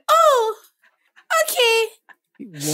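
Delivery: high, loud dopey cartoon voice; dopey cartoon bellow. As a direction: ham it up like a cartoon fool. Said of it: "how are you talking like that".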